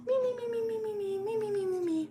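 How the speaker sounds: high, squeaky sustained "mi-mi-mi"; cartoon sleeping noise. Character trying to sleep at night, high cartoon snoozing sound.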